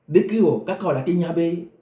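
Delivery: ordinary talk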